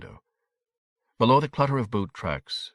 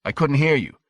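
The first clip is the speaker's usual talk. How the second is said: higher and louder, pressing hard; sharp demand; 6 semitones higher, 5 dB louder than usual. A narrator's reading. Father cross-examining his whispering son, sharply demanding. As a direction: press the boy to speak up.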